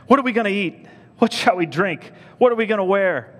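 high, loud fretting questions; anxious questioning. Acted. Preacher quoting the anxious worriers, high-pitched fretting. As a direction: fret aloud, pitched high. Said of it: "do not be anxious, saying".

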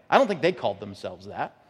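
usual speaking voice

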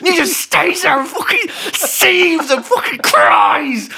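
high shouting rant breaking into laughter; laughing rant. Real, not acted. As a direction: rage at him while cracking up. Ranting, laughing while shouting.

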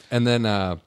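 normal talking voice

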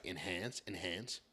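low, soft mock crime-show command; deadpan TV investigator, repeating the order; understated crime-drama parody; CSI tech. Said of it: "Just like C S I".

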